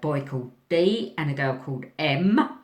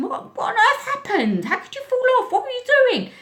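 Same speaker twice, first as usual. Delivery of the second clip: much higher, louder incredulous telling-off; exasperated scolding; 4 dB louder, faster than usual. Retold or imitated. Teacher scolding the boy who fell off, exasperated. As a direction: scold in disbelief.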